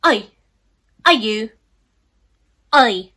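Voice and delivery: loud English "Oi!" called out across the room; English accent shout. Pretend. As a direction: bark "Oi!" in a mock English accent. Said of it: "talked with an English accent".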